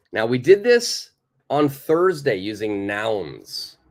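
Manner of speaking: ordinary delivery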